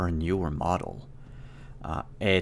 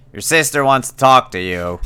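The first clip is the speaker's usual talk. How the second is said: calling out; 4 semitones higher, 7 dB louder than usual. Acted. Gina calling through door.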